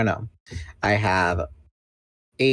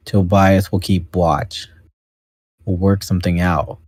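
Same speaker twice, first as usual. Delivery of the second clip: put-on deep teenager voice; 5 dB louder than usual. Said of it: "he just sounds like Eeyore now".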